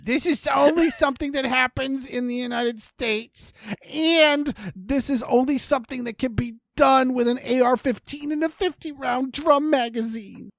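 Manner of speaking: exaggerated, high-pitched sarcastic voice; mock the claim in a put-on high voice; sarcasm font. Shown as a demonstration. Redoing the point for a co-host who missed the joke, hammed-up sarcasm. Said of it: "you didn't use your sarcasm font".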